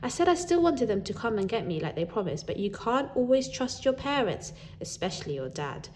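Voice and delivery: ordinary delivery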